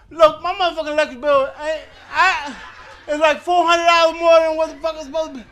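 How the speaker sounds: loud, indignant man voice. Acted. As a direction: drop the feminine act and complain loudly. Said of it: "bring the man voice out".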